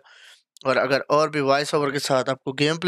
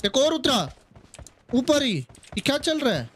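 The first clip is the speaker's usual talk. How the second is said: excited callout; 11 semitones higher than usual. Real, not acted. More enemies landing mid-fight.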